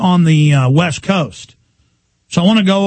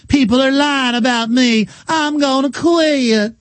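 high put-on voice, last words slow; mock her quitting in a high voice; mocking Sarah Palin; 9 semitones higher, slower than usual. Talk host mocking Palin, high put-on voice. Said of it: "she doesn't have a Georgia Peach accent".

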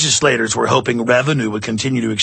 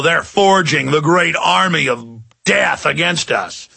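ordinary talk

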